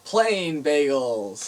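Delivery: slow, punchy punchline. Pretend. Land it slowly and proudly. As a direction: [name] delivering the bad joke punchline, slow and proud. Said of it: "we have a bad joke for you".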